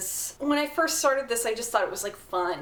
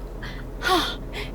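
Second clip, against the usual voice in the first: sharp gasp; 7 semitones higher, 4 dB quieter than usual. Real, not acted. Stepping out into the heat.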